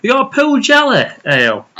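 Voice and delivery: high-pitched put-on Yorkshire; mock Yorkshire accent. Ordering an ale from a mate, mock Yorkshire accent. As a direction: order a pint in hammed-up Yorkshire. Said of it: "You keep saying Yorkshire accent".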